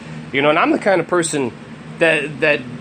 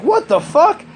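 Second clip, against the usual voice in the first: put-on very high squeaky what the fuck from the kid; the nephew after the trip, a high shocked squeal; stunned kid; 12 semitones higher, slower than usual. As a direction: mimic the tripped kid's disbelief. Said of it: "and he's like".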